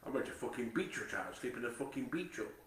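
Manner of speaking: normal talking voice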